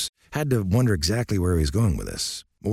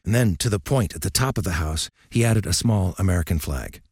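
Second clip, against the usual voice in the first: ordinary delivery, nothing marked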